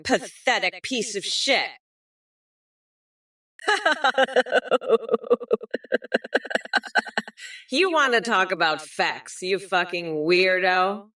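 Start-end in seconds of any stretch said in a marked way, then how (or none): 3.7-7.5 long high cackle; gleeful mocking cackle. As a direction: cackle at your own joke. Cackling at own insult.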